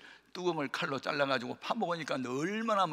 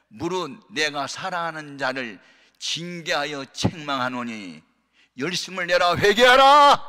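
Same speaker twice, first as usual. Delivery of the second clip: from slow reading to a booming call; preaching, building; 2 semitones higher, 11 dB louder, slower than usual. Acted. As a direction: build the verse up to a thunderous call to repent. Preaching scripture building to climax.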